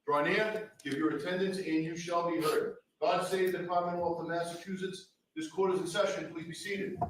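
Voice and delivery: formal courtroom proclamation, ringing; grand rich voice. Acted. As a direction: call the court to order like a crier. Court officer opens the session.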